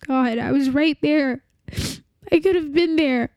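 ordinary delivery

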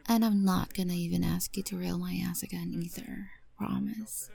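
whispering. Acted. Whispered intimate roleplay.

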